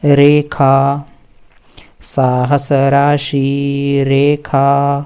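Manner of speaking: very slow साहस राशि, each syllable stretched; slow recitation. Shown as a demonstration. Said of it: "यहाँ से".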